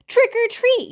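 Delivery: high, loud yell; children yelling. A narrator's reading. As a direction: shout it like excited kids. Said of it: "yelled the children".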